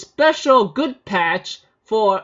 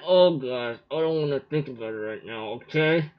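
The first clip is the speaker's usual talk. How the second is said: disgusted inner aside; 6 semitones lower, 6 dB quieter than usual. Retold or imitated. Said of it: "I think in my mind".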